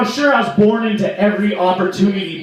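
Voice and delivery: usual speaking voice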